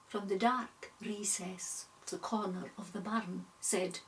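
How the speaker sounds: ordinary delivery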